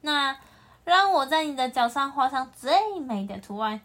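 plain reading voice

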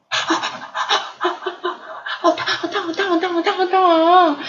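panting, then high rapid yelps; huff, then squeal as if scalded; burnt mouth. A narrator's reading. Ponyo biting hot ham, scalded yelps.

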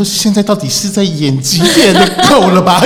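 slow, loud, laughing exasperation; mock disbelief through laughter; laughing aside. Guide thinks the crying scene is an act, laughing exasperation. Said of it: "我就心想说".

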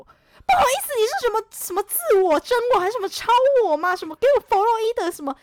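loud, high sarcastic rant; mock the pseudo-psychology with scorn; scornful outburst. Real, not acted. Mocking her ex's excuse, a loud scornful rant.

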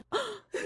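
sharp high gasp; surprised gasp. Shown as a demonstration. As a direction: gasp in shock. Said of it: "一个吃惊的概念". (吃惊的倒抽气)

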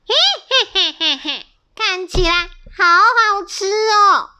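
a hungry bully eyeing the babies; villain dinosaur cackle. A narrator's reading. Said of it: "肚子很饿的恶霸龙走过来". (恶霸龙尖声奸笑)

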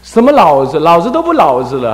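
loud, high, scolding a son at play; a mother snapping at her idle boy; shrill mother's rebuke, loud and high. Retold or imitated. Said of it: "他娘就骂他了".

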